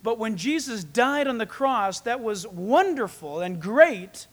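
ordinary talk, nothing marked

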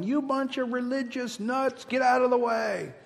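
high-pitched heckle hollered from a car window; annoyed driver's high holler; mock yelling. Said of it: "started yelling".

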